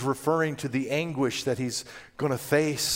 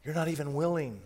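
much softer, sorrowful reproach; quiet grieving rebuke; 6 dB quieter than usual. Acted. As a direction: let the words sink in softly. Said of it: "But he said".

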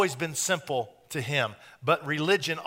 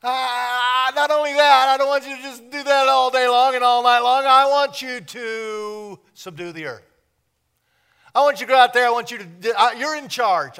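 much higher and louder, enthusiastic giver; God eagerly gives Adam and Eve the world; exuberant God; 7 semitones higher, 7 dB louder than usual. Retold or imitated. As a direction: proclaim it like a generous God. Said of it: "God was saying".

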